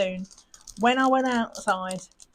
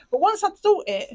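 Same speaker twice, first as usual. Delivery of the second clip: ordinary delivery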